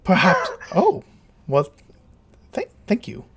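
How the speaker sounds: high, fluttery flustered thanks; flattered. Acted. Noble girl flustered by the hero's flattery: high flattered thanks. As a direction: caught off guard by the compliment, pleased. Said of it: "from angry to quite flattered".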